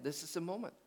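soft, understated delivery; hushed announcement. Acted. Preacher voicing Jesus to the congregation, hushed. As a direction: let it land quietly.

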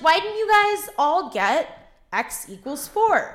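put-on high, slow valley girl voice; sing-song valley girl teacher. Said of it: "really valley girl voice".

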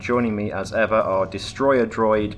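loud, mock-robotic show host intro; announcer voice.

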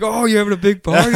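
high-pitched imitated teasing question; mock party banter. New neighbor playing along with antics, teasing banter.

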